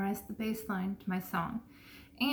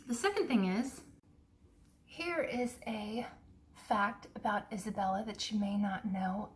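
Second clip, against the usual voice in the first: usual speaking voice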